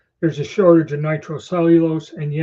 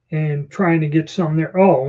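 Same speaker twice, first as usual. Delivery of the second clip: ordinary delivery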